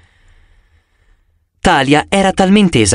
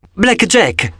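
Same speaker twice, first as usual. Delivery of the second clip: a happy, higher shout of greeting; exclaiming; 7 semitones higher than usual. A narrator's reading. Percy greeting his pegasus friend, calling out higher with joy. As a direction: call the name out high and glad.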